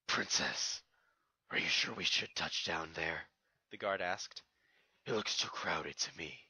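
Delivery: a soldier voicing doubt to his princess; deep wary guard; royal guard.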